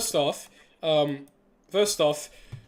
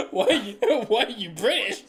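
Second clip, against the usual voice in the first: higher-pitched question broken up by laughter; laughing disbelief; 8 semitones higher than usual. Real, not acted. Host repeating a guest's odd question, laughing in disbelief. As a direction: try to ask it through helpless giggles.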